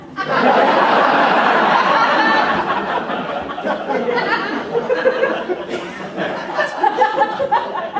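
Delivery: long high breathy laugh. Real, not acted. General laughs at his joke.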